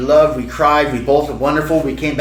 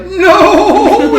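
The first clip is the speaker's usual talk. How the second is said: put-on very high, loud toddler wail; mock child's crying whine; crying little girl; 6 dB louder than usual. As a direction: imitate a bawling small child. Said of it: "And she's crying".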